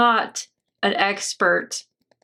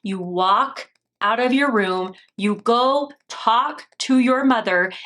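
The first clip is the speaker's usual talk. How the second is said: usual speaking voice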